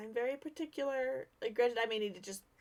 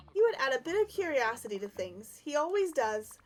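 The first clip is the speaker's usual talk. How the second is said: put-on bright, louder girlish Alice voice; storybook girl voice; 5 semitones higher, 4 dB louder than usual. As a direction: read it like a young storybook heroine.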